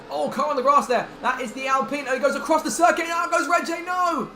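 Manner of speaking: shouting as the car crashes; yelling in real alarm; excited crash call. Real, not acted. Crash seen live.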